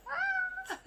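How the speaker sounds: high squeal of delight; real high-pitched fangirl squeal. Real, not acted. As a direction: squeal like you can't contain it. Gushing over cute scene.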